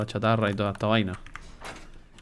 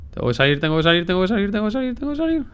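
repeated, climbing ever higher toward a frantic squeak; panicked chant as the air runs out; rising panic; 8 semitones higher, faster than usual. Real, not acted. Gamer running out of air underwater, pitch climbing in panic.

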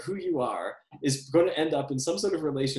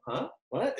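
imitating a sleep talker, pitched up; mock half-asleep questioning, lightly voiced; sleepy confusion; 7 semitones higher, 3 dB quieter than usual. Himself asleep answering ghostly voices on tape, mock sleepy confusion. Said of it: "You're like, I'm asleep".

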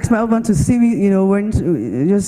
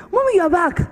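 put-on girl's delighted greeting; 7 semitones higher than usual. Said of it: "what the girl said".

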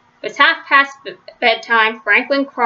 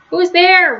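usual speaking voice

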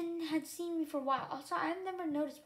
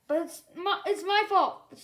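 sudden, louder, higher confession; blurted; 4 semitones higher, 9 dB louder than usual. A narrator's reading. Strongwings confessing to Mauser to shield her, blurted. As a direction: blurt it out before thinking. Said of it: "Strongwings blurted suddenly".

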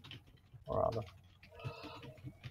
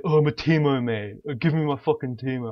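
loud higher whiny imitation of a toxic teammate; mocking a raging player; 4 semitones higher, 7 dB louder than usual. Retelling a flaming teammate, in a mocking loud whine. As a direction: ham up the entitled gamer. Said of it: "He's like".

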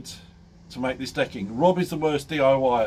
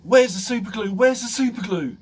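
higher, louder panicked yelling down the phone; retold panicked scream; 9 semitones higher, 5 dB louder than usual. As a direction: shout it like a man in a panic over his finger. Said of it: "Rob screaming down the phone".

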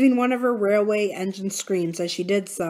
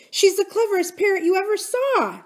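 aunt voice; 13 semitones higher, 3 dB louder than usual. A narrator's reading. Fond aunt.

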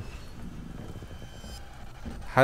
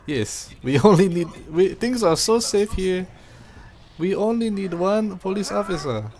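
mock Jamaican accent, louder and higher; 7 semitones higher, 4 dB louder than usual. Ironic Jamaican tourism pitch about the city's single police officer. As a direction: keep the tourist-ad Jamaican voice going. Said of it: "oh, Jamaican".

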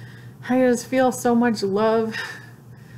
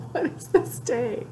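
higher, through a real laugh; laughing disbelief; 4 semitones higher, 3 dB quieter than usual. Real, not acted. Overwhelmed vlogger marvelling at the day, laughing disbelief. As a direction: laugh through the words in happy amazement.

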